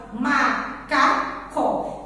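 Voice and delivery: "macaco" in three slow, separate beats; split into syllables. Shown as a demonstration. Teacher showing children how to split macaco, syllable by syllable. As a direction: say "macaco" slowly as ma, ca, co. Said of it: "Você vai separar as sílabas".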